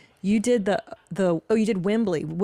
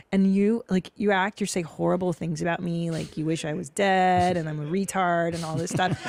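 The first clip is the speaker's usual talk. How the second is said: usual speaking voice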